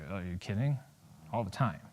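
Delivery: very low, quiet aside; muttered confession; deadpan admission. Acted. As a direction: answer under your breath, deep and dry. Muttered reply to the therapist.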